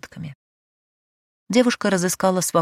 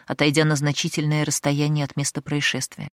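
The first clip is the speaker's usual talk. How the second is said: plain reading voice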